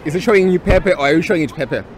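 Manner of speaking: ordinary talk, nothing marked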